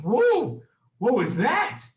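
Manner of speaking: loud, high-pitched startled reaction; startled kids. Acted. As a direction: blurt it out as shocked children. Startled kids react to mom yelling.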